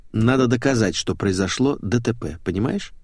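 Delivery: usual speaking voice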